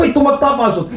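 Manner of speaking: high, loud laughing yell; fake-shout a threat for laughs; mock threat shout. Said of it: "No en mä sanonut sitä tolleen". (leikillinen uhkaushuuto)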